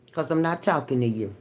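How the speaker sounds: usual speaking voice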